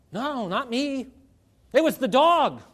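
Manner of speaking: lightly voiced child blaming the dog; child denying.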